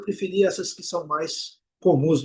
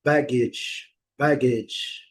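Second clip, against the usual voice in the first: "baggage" as BAG-idge, falling after BAG; teacher's drill of "baggage" with front stress; first syllable stressed; 2 semitones lower, much slower than usual. Shown as a demonstration. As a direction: punch the first syllable of "baggage", weak ending. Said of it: "a sílaba forte vai ser a primeira".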